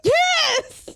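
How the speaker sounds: high, loud outburst; emphatic yes. Real, not acted. Teller admitting to kept laughing, emphatic shout. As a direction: shout the answer out. Emphatic answer to listener's question.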